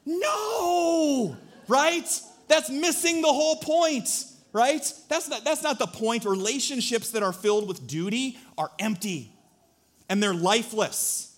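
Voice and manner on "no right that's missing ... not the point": high, loud emphatic protest. Real, not acted. Preacher rejects duty-bound romance, loud and high. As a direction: insist that's the whole point.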